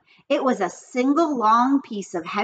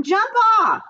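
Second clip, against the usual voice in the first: very high, louder command; bossy shout; 12 semitones higher, 5 dB louder than usual. A narrator's reading. Fern shouting at Avery to get off, very high and loud. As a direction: shout him off the swing.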